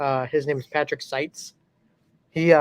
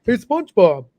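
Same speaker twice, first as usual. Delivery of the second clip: Patrick Star; 5 semitones higher, 7 dB louder than usual. Retold or imitated. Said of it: "Patrick's kinda easy. He just sounds dumb".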